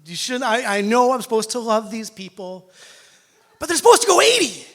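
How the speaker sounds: sorry, but they should go eighty; mock apology to daughter. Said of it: "I'm like".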